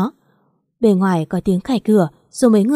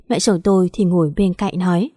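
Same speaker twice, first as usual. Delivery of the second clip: ordinary delivery, nothing marked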